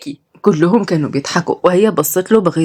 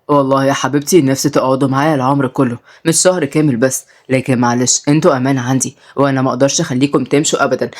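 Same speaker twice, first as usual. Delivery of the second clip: put-on lower, easygoing old man; old grandpa; 5 semitones lower than usual. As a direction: talk like a fond grandfather, low. Grandpa voiced.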